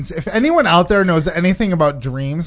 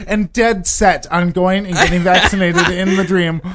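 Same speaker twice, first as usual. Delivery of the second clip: usual speaking voice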